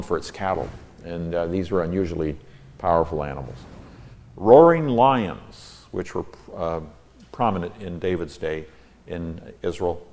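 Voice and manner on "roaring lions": slow, loud and high "Roaring lions"; big dramatic call; roaring proclamation. Acted. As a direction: draw it out like a roar. Dramatic psalm reading.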